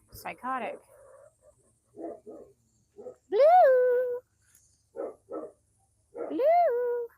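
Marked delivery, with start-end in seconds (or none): 3.3-7.1 very loud, high, long-held call. Real, not acted. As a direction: holler the name across the yard. Calling a missing hen.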